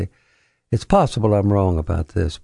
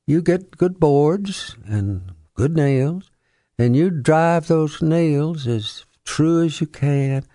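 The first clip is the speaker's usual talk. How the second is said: lightly imitating a folksy Southern author; Faulkner impression; 7 semitones higher than usual. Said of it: "Mister Faulkner".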